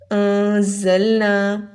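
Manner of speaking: انزلنا very slowly with a buzzing whistled z; whistling za. Shown as a demonstration. Teacher gives example of za, slow انزلنا with whistled z. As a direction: stretch انزلنا out, sharp z in the middle. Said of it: "ان دونوں میں difference must کرنا ہے".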